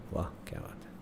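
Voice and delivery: awed whisper. Real, not acted. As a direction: whisper it in admiration. Admiring campus temple, hushed.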